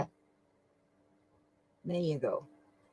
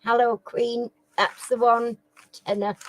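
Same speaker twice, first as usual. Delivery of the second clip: ordinary talk, nothing marked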